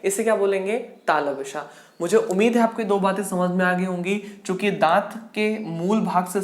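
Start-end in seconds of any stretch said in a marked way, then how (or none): none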